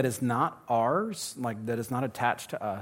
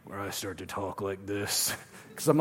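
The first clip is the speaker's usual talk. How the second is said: Batman voice; 5 semitones lower, 4 dB quieter than usual. Shown as a demonstration. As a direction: drop into a gruff Batman rasp.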